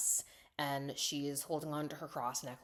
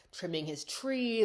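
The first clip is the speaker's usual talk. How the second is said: ordinary talk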